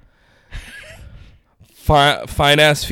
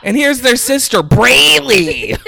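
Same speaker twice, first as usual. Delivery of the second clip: mock proud mom voice; 9 semitones higher than usual. Introduces fictional kids, high voice.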